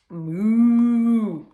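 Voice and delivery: one long, loud moo; cow moo. A narrator's reading. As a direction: let out a drawn-out cow call. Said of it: "said the cow".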